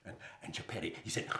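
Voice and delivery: plain reading voice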